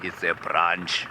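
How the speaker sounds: slow, low tracker's voice; deep bass impression, unhurried. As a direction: say it slowly, way down deep. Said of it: "he had a voice deeper than James Earl Jones's".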